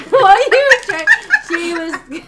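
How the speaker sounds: high, loud laughing. Real, not acted. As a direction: squeal it mid-laugh. Laughing hard.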